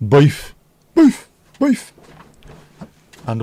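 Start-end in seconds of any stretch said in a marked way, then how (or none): 0.1-1.9 loud, high mock-Brummie yelps; exaggerated Birmingham vowel, pitched way up; Brummie accent. Shown as a demonstration. Crisp fan blurting out a flavour in a mock Brummie accent.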